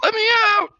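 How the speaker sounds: very high squeaky voice for the dog. Retold or imitated. Streamer voicing her dog begging to be let out, squeaky high plea. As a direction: voice the dog pleading at the door.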